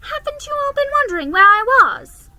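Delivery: put-on high, loud amazed girl; a girl amazed that no one missed her. A narrator's reading. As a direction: sound astonished. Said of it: "in amazement".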